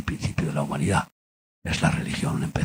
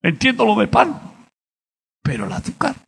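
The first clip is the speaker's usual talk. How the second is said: bewildered plea; 6 dB louder than usual. Retold or imitated.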